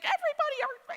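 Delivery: high, loud, frantic mock cry; freaking-out mom. Mom to her boys outside church, acted freak-out. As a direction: call out like a mother losing it. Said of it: "she's freaking out".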